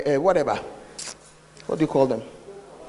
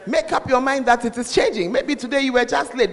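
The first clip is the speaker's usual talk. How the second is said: ordinary talk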